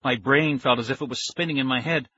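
ordinary delivery

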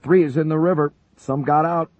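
high, loud report over the rotor noise; shouting. A narrator's reading. Gunner reporting the downed crew to the major, shouting. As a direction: yell the news over the intercom. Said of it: "Oakes shouted over the intercom".

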